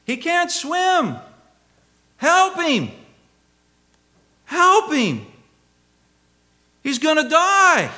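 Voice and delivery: high, drawn-out panicked scream, imitating the sister; screaming for help. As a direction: cry out desperately as if someone is drowning. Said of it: "she began screaming".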